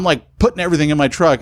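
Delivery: ordinary talk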